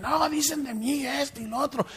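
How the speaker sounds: high, soft mock self-pity; a sneering take on a fake innocent; mocking whine. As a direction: mimic the liars' pious excuse. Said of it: "Ya hacen videos y dicen".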